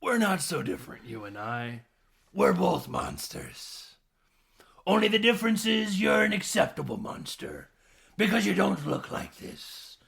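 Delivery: loud, high put-on villain voice; Danny DeVito. Host playing the villain confronting a fellow monster, theatrical villain voice. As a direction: declaim it as a hammed-up monster, loud and strained. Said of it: "DeVito lets him know".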